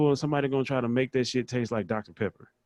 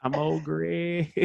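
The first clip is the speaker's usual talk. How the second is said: put-on slow drawn-out Old Greg line; Old Greg impression; 7 semitones higher, much slower than usual. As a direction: drag the name out like Old Greg. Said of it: "the way he said it".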